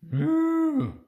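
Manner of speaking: high loud mooing cow. Pretend. Parent reading to a child, a loud high cow moo. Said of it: "Mister Brown can moo".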